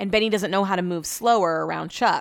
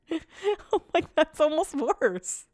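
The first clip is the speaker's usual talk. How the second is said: high-pitched, through laughter; laughing; 8 semitones higher, much slower than usual. Real, not acted. Telling of the silly pizza suggestion, laughing helplessly. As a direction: barely get it out for laughing. Laughing at husband's dinner idea.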